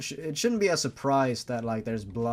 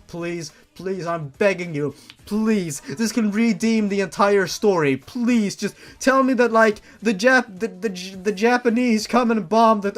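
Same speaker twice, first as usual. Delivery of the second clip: pleading louder and higher with each please; begging; 6 semitones higher, 8 dB louder than usual. Real, not acted. Reader begs the author for a plot twist, building pleas. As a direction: mock-desperate begging that builds to a yell.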